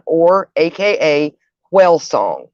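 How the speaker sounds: ordinary talk, nothing marked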